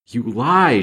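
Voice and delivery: loud, high cry of denial; despairing furious outburst; shriek of rage. A narrator's reading. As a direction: fling the accusation out in rage and despair. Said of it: "she shrieked in a voice of horrible rage and despair".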